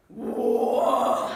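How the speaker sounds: loud, long mock-possessed "whoa"; possessed roar. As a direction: bellow like a mother possessed. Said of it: "this voice comes out of".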